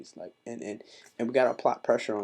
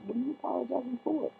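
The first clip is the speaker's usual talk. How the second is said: incredulous question. Retold or imitated. Mocking Muslims who apologize.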